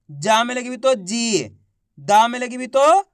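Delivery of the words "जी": जी held long, then dropping; drawn-out long ī. Shown as a demonstration. Teacher reading syllables for class to copy, drawn out. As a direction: stretch out जी. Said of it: "खींच के पढ़ेंगे".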